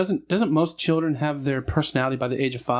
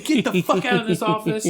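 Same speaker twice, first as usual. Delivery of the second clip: retold angry order; 7 semitones higher than usual. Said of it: "She said".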